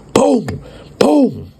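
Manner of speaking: high shouted boom; high shouted door kick. Retold or imitated. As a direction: sound out the door crashing in.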